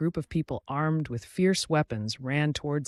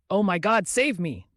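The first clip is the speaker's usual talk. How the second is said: raised, higher-pitched cry for rescue; louder, urgent plea, still in narration; shout for help; 5 semitones higher, 5 dB louder than usual. A narrator's reading. Hero fleeing goblins calls to be saved, restrained shout for help. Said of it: "shouted for help".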